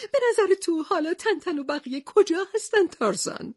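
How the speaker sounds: high, anxious cry of dismay over the wreck; panicked sailor staring at ruins; frightened. A narrator's reading. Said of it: "کاپیتان هادوک وحشت زده گفت".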